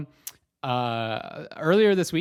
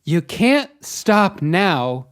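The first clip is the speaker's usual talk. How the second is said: louder, slow insistent self-command; self-pep talk; 6 semitones higher, 9 dB louder, much slower than usual. Retold or imitated. Man on the stairs urging himself upward, loud and slow. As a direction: push yourself on firmly. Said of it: "I literally said back to myself".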